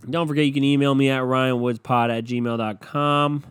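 ordinary talk, nothing marked

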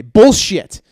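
loud, high-pitched burst of disbelief; upset. Retold or imitated. Dentist rejecting the patient's claim to floss, upset. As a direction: cut in hard and accusing. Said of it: "they would get upset with me".

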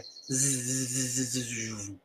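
teacher's long pulsing zzz; demonstrated voiced z hum; sustained z buzz. Shown as a demonstration. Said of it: "Este sonido".

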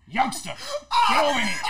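loud, high mock summons; imitating Rick yelling. Actor imitates Rick yelling him over, loud mock order. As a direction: bark the order in Rick's voice. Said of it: "you sound just alike".

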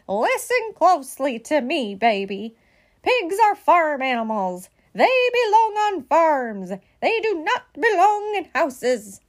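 slow, insistent scolding; bossy lecturing. A narrator's reading. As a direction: lay down the law slowly, building in force. Bossy sister lectures Baby on pigs.

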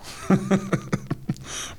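long, quiet knowing laugh; soft, drawn-out villain chuckle; sinister chuckle. Acted. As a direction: chuckle softly before a reveal. Villain laughs before revealing his name.

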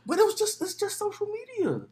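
tiny, very high, soft voice; mock-innocent protest. Scolded co-host playing down social media, meek high voice. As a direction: shrink it to a squeaky little excuse.

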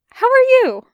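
high, loud strained greeting; overeager. Acted. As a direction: blurt it out way too brightly. Awkward eager phone greeting.

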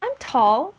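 put-on offended kid standing up for herself; mildly offended. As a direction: protest like a slightly offended little girl. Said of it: "She's kind of a little offended".

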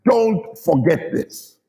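slow, pointed warning to a son; measured, emphatic parental command; stern fatherly warning. Acted. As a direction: spell it out slowly, like a finger-pointing dad. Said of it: "pointing his finger like I would".

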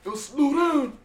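winded sergeant. Retold or imitated. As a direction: mimic the sergeant wheezing to slow down. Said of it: "He was like".

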